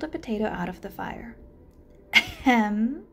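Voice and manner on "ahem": pointed ahem. Acted. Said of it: "said Spider".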